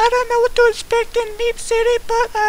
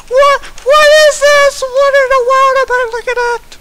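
loud, higher, disbelieving; shocked outcry; 4 semitones higher, 8 dB louder than usual. Real, not acted. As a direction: shout it out in disbelief. Shocked by game content.

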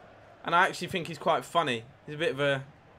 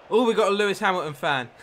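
loud, high burst of delight; excited exclamation; 6 semitones higher, 9 dB louder than usual. Real, not acted. Streamer thrilled to spot a Hamilton fan in chat, loud and high. Gamer reacts to a chat comment.

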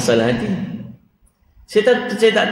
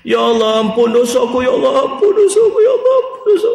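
imitating crying prayer; 9 semitones higher than usual.

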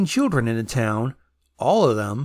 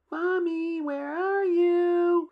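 put-on high, soft ghost child; creepy child voice; 14 semitones higher, 4 dB quieter, much slower than usual. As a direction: call sweetly like a dead child. Dead child searching for mother.

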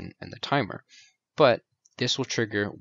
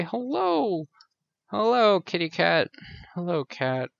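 much higher, squeaky little-bug greeting; tiny beetle voice; 7 semitones higher than usual. Pretend. The beetle answers the cat in a high squeaky voice. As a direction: chirp it like a tiny happy beetle.

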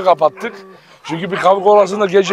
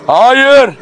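loud, raised-pitch command; firm shouted no; 6 semitones higher, 6 dB louder than usual. Real, not acted. Owner stopping animals about to fight, firm shout. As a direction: shout to stop them in their tracks.

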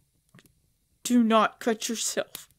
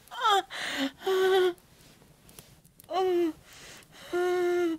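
pained gasps; 9 semitones higher, much slower than usual. Acted. Gasping in pain mid-sentence.

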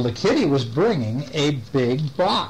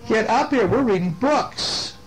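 calling out; 7 semitones higher than usual. Real, not acted. Reader calls a child up to read books, beckoning.

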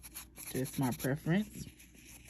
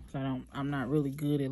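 ordinary talk

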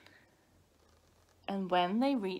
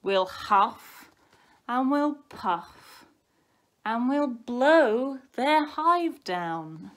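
slow, loud, building chant; big bad wolf threat; 3 semitones higher, 8 dB louder, much slower than usual. A narrator's reading. Wolf to bees in their hive, slow loud threat. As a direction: puff up the threat as it grows.

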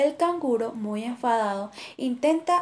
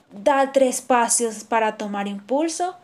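ordinary delivery, nothing marked

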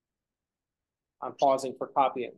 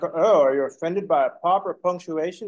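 louder, put-on complaint of an old person; mock complaining; 3 semitones higher, 6 dB louder than usual. Teacher mimics an old person complaining about texting, mock indignant. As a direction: imitate someone griping at the young. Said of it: "I saw a person complaining".

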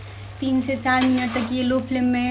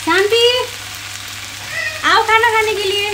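louder and higher, calling across the house; 9 semitones higher, 4 dB louder than usual. Real, not acted. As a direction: call her to come and eat, loud and high. Calling someone to come eat.

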